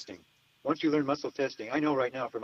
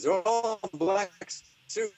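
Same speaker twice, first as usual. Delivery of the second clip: slow, higher mock Irish drawl; 7 semitones higher, slower than usual. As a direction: imitate the Irish man describing them. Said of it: "I'm gonna stop doing my Irish".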